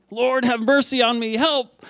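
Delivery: high-pitched cry for mercy, acted out; imitated shouting. Preacher acts out the blind man crying out to the Lord, high-pitched cry. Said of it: "He's shouting".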